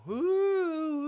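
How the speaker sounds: long high "woo"; excited whoop. Real, not acted. Player cheering own check in chess, high whoop.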